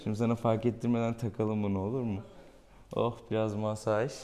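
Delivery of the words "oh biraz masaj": ordinary talk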